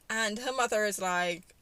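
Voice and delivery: usual speaking voice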